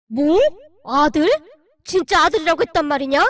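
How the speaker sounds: loud, startled exclamation; shocked shout. Acted. Father-in-law hearing the baby is a boy, loud and startled. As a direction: jolt at the word son. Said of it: "시아버님의 눈이 커져 있었어요".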